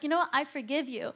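normal talking voice

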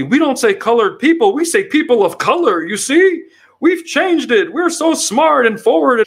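mocking a smug liberal; mock smug Democrat. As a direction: play a preening know-it-all.

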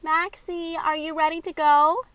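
high, loud call up the stairs; yelling across the room. Acted. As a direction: shout it up to her sister. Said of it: "called up the stairs to her sister".

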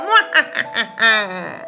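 long villainous mwa-ha-ha; hammed-up villain laughter; evil cackle. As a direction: cackle over a wicked plan. Villains laugh after plotting mischief.

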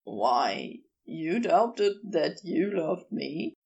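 low, slow character voice; clarify slowly through a little grin; teasing, crooked grin. A narrator's reading. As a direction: drop low and take it slowly. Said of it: "Crowley clarified with a little crooked grin".

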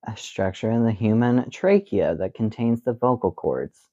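usual speaking voice

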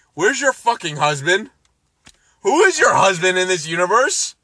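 ordinary talk

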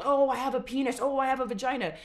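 mock announcement. Refusing to tell the world their body, so mocking a loud proclamation. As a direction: ham up a silly public announcement. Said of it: "I don't have to fucking tell the world".

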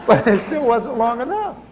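laughing through the words; amused at his own joke. Real, not acted. Preacher jokes to the congregation about sinning, laughing.

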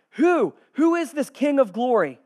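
loud, high, insistent question; gatekeepers' response. Acted. Gatekeepers responding again to the herald, loud demand. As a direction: call out the question forcefully. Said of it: "the gatekeepers respond again".

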